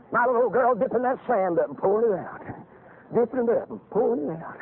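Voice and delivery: ordinary talk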